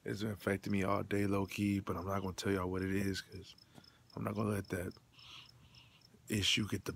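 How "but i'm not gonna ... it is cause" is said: normal talking voice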